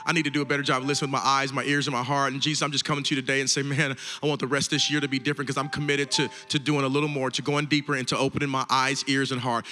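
low, softened praying voice; earnest prayer. Real, not acted. Pastor leading the congregation in prayer after the message, low earnest prayer.

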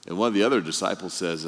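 usual speaking voice

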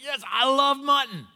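high, loud, whooping yes; hearty enthusiasm. Retold or imitated. College buddy accepting dinner, loud and hammed-up. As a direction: accept with over-the-top gusto.

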